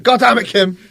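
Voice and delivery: hammed-up angry shout; Jack Bauer. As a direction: curse at her like a fed-up father. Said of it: "Jack Bauer's first".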